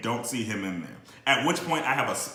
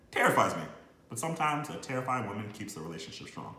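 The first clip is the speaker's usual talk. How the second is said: ordinary delivery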